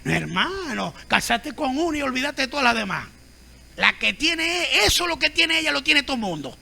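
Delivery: a lightly voiced scoffing listener; mock proud man's retort. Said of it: "El orgulloso dice".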